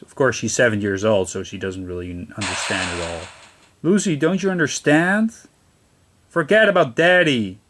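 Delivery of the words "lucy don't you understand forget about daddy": higher, sharper scolding mother; harsh mother. Pretend. Mother telling Lucy to forget her father, mock harsh voice. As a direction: snap it at the child.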